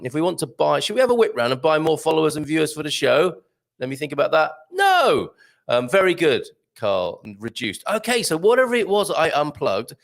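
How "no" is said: sudden high emphatic no; comic flat no. Pretend. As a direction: snap a playful refusal. Mock thinking, then flat refusal.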